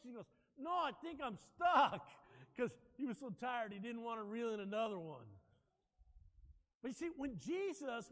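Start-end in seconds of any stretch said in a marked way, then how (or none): 0.6-2.1 slow, raised-pitch shout; loud insistence. Retold or imitated. Worn-out fisherman contradicting the deckhand, loudly insistent. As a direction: insist loudly, dragging out each word.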